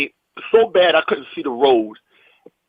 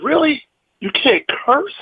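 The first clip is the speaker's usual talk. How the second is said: high, incredulous questioning; mock disbelief; 10 semitones higher, slower than usual. Comic to club owner about the cursing ban, incredulous. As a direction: replay being baffled by a silly rule.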